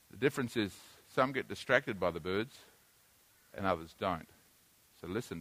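quiet, low aside to the room; calm them down about the bird, softly; hushed low aside. Real, not acted. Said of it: "Now listen to me".

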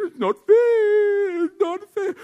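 very high, drawn-out mock whine; hammed-up tearful kid protesting; wailing child imitation. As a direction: wail it like a sulking child. Child's complaint acted out.

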